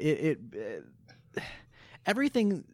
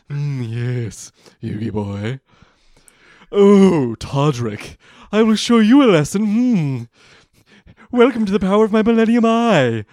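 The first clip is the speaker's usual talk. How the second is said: Pegasus voice; 3 semitones higher, 12 dB louder than usual. Acted. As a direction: ham up the smug card villain. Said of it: "into my Pegasus voice".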